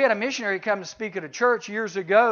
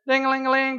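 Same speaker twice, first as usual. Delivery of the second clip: sing-song bell ding higher; mock bell ring; 5 semitones higher, 3 dB louder than usual. Preacher imitating Pavlov's bell, light sing-song ding. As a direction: chime it out like a dinner bell.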